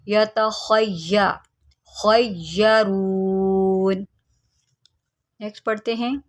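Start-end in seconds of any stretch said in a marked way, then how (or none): 0.1-4.1 खय्यरून with a thick, heavy ख; heavy kha. Shown as a demonstration. Teacher showing students the heavy letter, slow recitation. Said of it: "ख्वा को मोटा करें".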